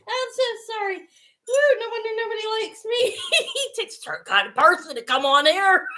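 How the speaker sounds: high voice squeezed by real laughter; talking through a laughing fit; laughing hard. Real, not acted. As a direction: apologise while helpless with giggles. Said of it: "I am laughing so hard".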